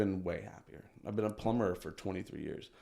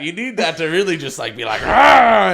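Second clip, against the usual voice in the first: shout; 9 semitones higher, 18 dB louder than usual. Retold or imitated. Comedian describing being aggressive.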